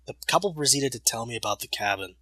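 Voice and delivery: plain reading voice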